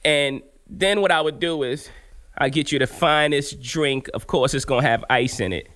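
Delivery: usual speaking voice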